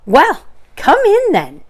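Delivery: grudging welcome; Rabbit inviting. Acted. Rabbit letting Pooh in, slow grudging.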